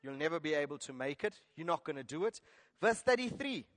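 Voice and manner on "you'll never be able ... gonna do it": lower, dismissive naysayer; mock discouraging voice; voice of discouragement. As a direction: talk them down in a low voice. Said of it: "voices of discouragement".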